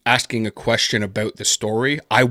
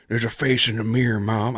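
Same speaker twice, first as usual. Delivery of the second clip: silly Southern accent. Shown as a demonstration. Narrator shows how the story got read, silly Southern accent. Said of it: "in that silly accent".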